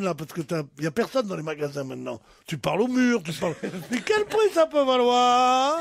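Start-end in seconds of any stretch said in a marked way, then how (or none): 3.9-5.8 higher, drawn-out musing question; lightly hammed-up curiosity over a price; mock wondering. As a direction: wonder aloud, stretching the last word. Acts out wondering at hat's price.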